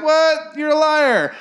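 high, fake-shocked accusation; squeaky put-on disbelief; mock outrage. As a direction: fake shock to cover being caught. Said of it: "trying to front and be like".

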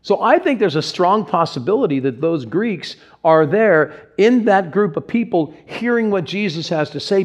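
ordinary talk, nothing marked

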